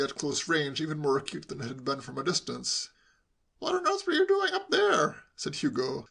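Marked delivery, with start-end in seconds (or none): 3.6-5.2 high-pitched, insistent question; inquisitive nephew. A narrator's reading. Nephew quizzing uncle after his descent, inquisitive. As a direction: ask afresh in a high, nosy voice. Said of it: "starting again at the beginning".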